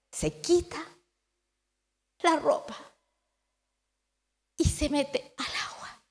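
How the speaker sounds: ordinary talk, nothing marked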